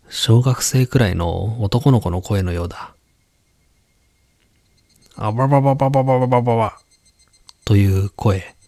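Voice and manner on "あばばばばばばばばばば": fast, high bubbling babble; underwater babbling. Acted. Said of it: "水に潜って声を出しているような".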